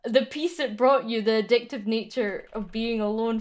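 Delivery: ordinary delivery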